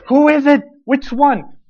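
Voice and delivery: demanding to know at once; urgent demand. Retold or imitated. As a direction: Peter insisting on a name. Peter demanding an answer.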